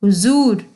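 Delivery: हुजूर with z in the middle; correct pronunciation. Shown as a demonstration. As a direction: sound हुजूर as huzoor. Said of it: "ज पढ़ते हैं, तो यह बन जाएगा". (हुजूर में ज़ की आवाज़ निकालना)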